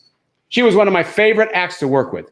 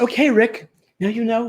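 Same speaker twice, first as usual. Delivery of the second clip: lightly voiced lady star, pitched up; a hint of a genteel employer; Ginger Rogers; 7 semitones higher than usual. Star interviewing an opening act, lightly higher voice. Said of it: "And she said".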